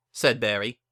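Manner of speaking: ordinary delivery, nothing marked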